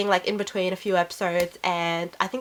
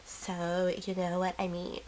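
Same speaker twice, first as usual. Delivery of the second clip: much softer, lisping, dreamy voice; soft lisping Drew Barrymore imitation; Drew Barrymore impression; 3 semitones lower, 13 dB quieter than usual. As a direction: mumble it softly with a lisp. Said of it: "when Kristen does a lisp".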